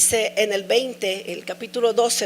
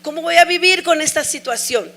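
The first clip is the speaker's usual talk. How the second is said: higher, louder despairing questions; retold grief, pitched up and pressing; anguished questioning; 7 semitones higher, 4 dB louder than usual. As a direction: ask how to go on, near breaking. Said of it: "y decía".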